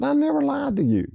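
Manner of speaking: high protesting voice, mock wounded; defensive protest. [name] protesting to Dale, high mock defensive. Teller defending himself in retold argument.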